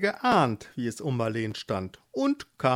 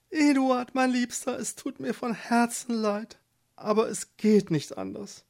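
higher, tender apologetic woman's voice; sorry wife; 9 semitones higher than usual. Acted. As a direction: say goodbye to the choice, heartbroken.

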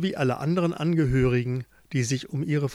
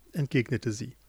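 ordinary delivery, nothing marked

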